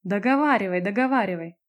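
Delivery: mildly menacing prompt; threatening. A narrator's reading. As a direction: dare her to finish, with menace. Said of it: "угрожающе произнесла Лариса".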